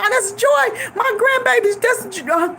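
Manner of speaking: high, animated mama voice; imitating excited mama. Mama realizing it's her grandbaby's birthday, high excited voice. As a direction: pitch it up as mama remembering.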